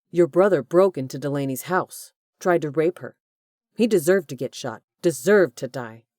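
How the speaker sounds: loud, angry accusation; angry confrontation. A narrator's reading. Corbin confronting Hugh about his brother, loud and angry. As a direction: throw it in his face.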